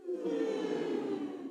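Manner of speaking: high, soft sobbing hum; sob again. Shown as a demonstration. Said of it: "And again".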